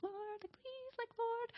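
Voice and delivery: tiny, high, very soft plea; pleading prayer. Retold or imitated. Staff member begging the Lord, in a tiny hushed voice. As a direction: pray under your breath, high and small. Acted silent plea while standing.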